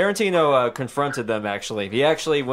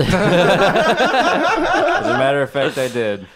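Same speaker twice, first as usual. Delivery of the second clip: slow, eerily calm doll voice; too calm creepy doll; evil doll voice; 5 dB louder, slower than usual. Acted. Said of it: "He sounds like an evil doll".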